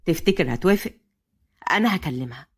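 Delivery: low, pensive male voice; thoughtful. A narrator's reading. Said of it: "فهد بتفكير". (بتفكير)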